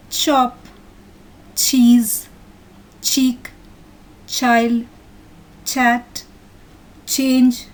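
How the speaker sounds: each word said alone, starting on a clear "ch"; word-list pronunciation. Shown as a demonstration. Teacher reading words to children for pronunciation, careful word-list delivery. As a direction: read "chop" to "choose" one by one, with pauses. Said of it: "I'll read out the words".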